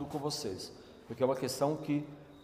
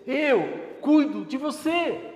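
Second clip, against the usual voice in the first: higher, louder, very slow promise; Jesus reassuring; 10 semitones higher, 7 dB louder, much slower than usual. Acted. Preacher voices Jesus promising care to each listener, slow and fervent. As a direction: lean into each word of comfort. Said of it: "É isso que ele quis dizer".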